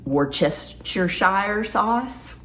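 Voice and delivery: slow and careful, rising at the end. Real, not acted. Careful slow tryout of a hard word. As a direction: sound it out slowly to a viewer. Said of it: "Why do I slow down when I say that".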